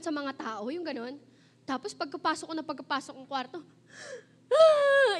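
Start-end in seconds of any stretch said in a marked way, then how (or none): 4.5-5.1 loud, high crying wail; mock wail. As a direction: burst into a hammed-up bawl. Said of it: "iyak talaga ako sa Lord".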